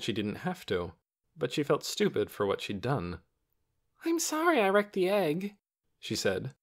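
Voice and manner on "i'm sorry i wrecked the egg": high-pitched, regretful apology; upset apology. A narrator's reading. Lena feels stupid after throwing the egg, upset apology.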